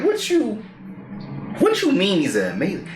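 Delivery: high, incredulous question; can't believe what he heard; stunned disbelief. Retold or imitated. Asking grandpa about his praise, high and stunned.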